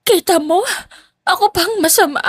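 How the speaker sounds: high, whiny tearful complaint; still crying. A narrator's reading. Stepmother still crying to her husband, tearful complaint. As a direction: play the victim through tears. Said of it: "Umiiyak pa rin".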